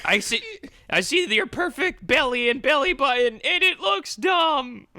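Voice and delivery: high, slowing mock-crying whine; crying insult. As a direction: drag it out through fake tears. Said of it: "while crying".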